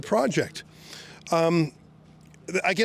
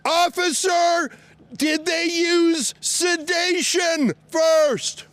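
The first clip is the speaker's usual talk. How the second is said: calling out; 9 semitones higher, much slower than usual. Real, not acted. Calling questions to police cars.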